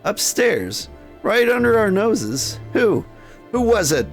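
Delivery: high-pitched, alarmed disbelief; high, startled questioning; shocked. Acted. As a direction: demand answers in acted shock. Madam hears of murder upstairs.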